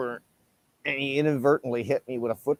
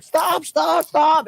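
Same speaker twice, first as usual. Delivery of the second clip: high, loud, repeated shout at the dog; screaming stop; 5 dB louder, much slower than usual. Retold or imitated. Owner screaming at his attacking dog to stop. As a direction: yell it to call the dog off. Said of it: "I started screaming at my dog".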